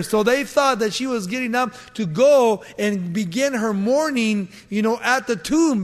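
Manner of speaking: fervent sermon voice held over the scripture; preaching at full force. Acted. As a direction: drive the story home to the congregation.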